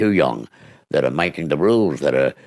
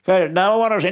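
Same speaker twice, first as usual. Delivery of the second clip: higher, insistent voice; wife insists on seeing the burglar; wife insisting; 13 semitones higher than usual. Retold or imitated. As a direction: refuse and push to look.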